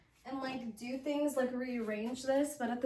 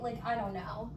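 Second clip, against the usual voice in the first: usual speaking voice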